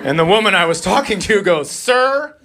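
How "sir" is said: mock-prim shock, stretching each word; offended clerk. Offended clerk calls him racist.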